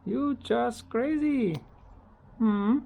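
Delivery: high, slow and teasing; fond pet teasing. Real, not acted. Owner teases her bird, high and slow.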